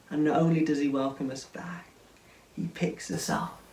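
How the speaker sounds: usual speaking voice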